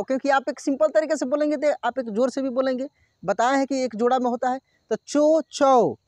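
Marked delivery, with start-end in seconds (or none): none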